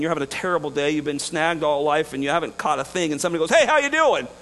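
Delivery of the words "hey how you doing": bright, loud, high hail of a passerby; cheery greeting. Retold or imitated. Stranger hails a struggling fisherman, chipper and loud. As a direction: call out breezily across the water.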